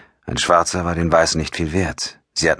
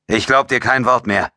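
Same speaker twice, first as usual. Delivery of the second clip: higher, mildly angry outburst; blurted out; 7 semitones higher than usual. A narrator's reading. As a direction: push the words out in disbelief. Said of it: "stieß Ryan hervor".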